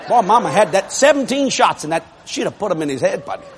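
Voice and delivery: ordinary delivery, nothing marked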